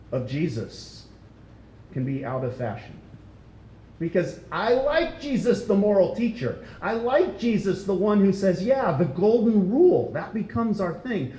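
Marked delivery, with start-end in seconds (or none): 4.0-11.3 mock casual believer. Voicing culture's easy view of Jesus.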